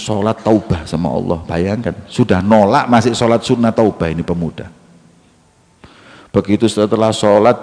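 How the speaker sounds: normal talking voice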